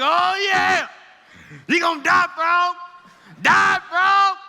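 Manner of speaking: shouted high and loud, cocky glee; gloating shout. Retold or imitated. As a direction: yell at the frog like you're winning a race. Boy gloating over boiling frog.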